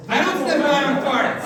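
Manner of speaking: loud, high indignant denial. Acted. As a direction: protest loudly and high. Comic denies smelly room.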